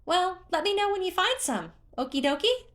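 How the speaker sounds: very high, loud cheer; cheerful creature. A narrator's reading. Hestu spotting Link, cheering in a very high voice. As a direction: squeal with glee at a friend.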